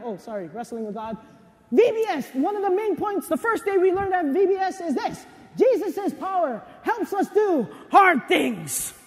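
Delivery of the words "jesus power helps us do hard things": slow, ringing proclamation; preaching shout. Real, not acted. Preacher proclaiming the day's lesson to the congregation, shouting. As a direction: drive the main point home.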